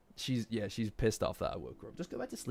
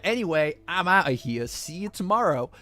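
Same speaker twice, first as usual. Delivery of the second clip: louder, higher retold brush-off; breezy goodbye; 7 semitones higher, 8 dB louder than usual. Anime guy retold leaving the girl at her house, loud breezy goodbye. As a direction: call out a breezy exit, imitating him.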